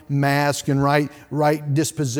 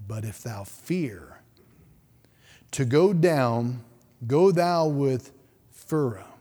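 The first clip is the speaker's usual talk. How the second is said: scripture reading. Acted.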